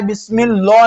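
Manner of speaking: बिस्मिल्लाह with heavy lam and rounded "o"; बिस्मिल्लाह darkened, the mistake to avoid; wrongly thickened lam. Shown as a demonstration. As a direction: say बिस्मिल्लाह as "bismillo". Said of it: "नहीं पढ़ना है".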